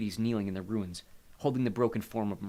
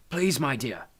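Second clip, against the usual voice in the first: higher, agitated plea; agitated pleading; 4 semitones higher, 5 dB louder, slower than usual. A narrator's reading. Hades to the woman he loves, agitated. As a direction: plead urgently with a loved one. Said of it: "like he was agitated".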